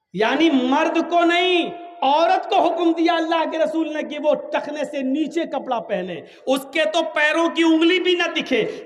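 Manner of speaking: preacher hammering home the rule with a laugh; emphatic preaching. Acted. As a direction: drive the ruling home. Preacher stresses the ruling.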